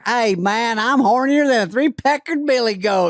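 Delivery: high, loud hammed-up Southern American accent. Southern American accent mocked to a co-host. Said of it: "does a great British, and you wouldn't believe it 'cause she's like".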